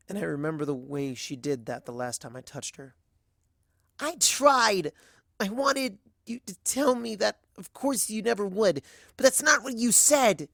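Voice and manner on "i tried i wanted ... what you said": much higher, louder, near tears; a tearful mare defending herself, high and loud; upset plea. Acted. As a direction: plead with him, close to breaking. Upset pony pleads, much higher.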